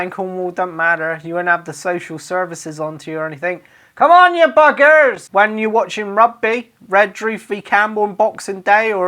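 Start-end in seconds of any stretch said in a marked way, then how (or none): 4.0-5.3 mock shout. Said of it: "you might want to shout that".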